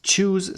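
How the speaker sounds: a clear CH opening "choose"; CH as in chair. Shown as a demonstration. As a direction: say "choose" with a CH at the start.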